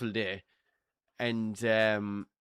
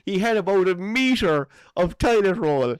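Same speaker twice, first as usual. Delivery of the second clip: laughing while telling; 8 semitones higher, 7 dB louder than usual. Real, not acted. Cracking up at his own story.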